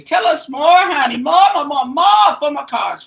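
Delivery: loud, high pleading at the cards; excited pleading. Real, not acted.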